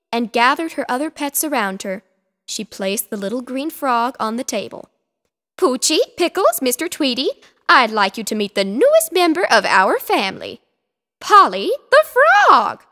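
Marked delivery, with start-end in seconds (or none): 5.6-12.8 louder, higher proud lady voice; proud announcement. Acted. Missus Picasso introducing the frog to her pets, proud. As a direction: present the new family member.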